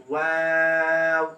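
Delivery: ওয়াও slow with rounded lips at the end; Arabic letter waw. Shown as a demonstration. Teacher giving the correct rounded ওয়াও. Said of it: "লাস্টে".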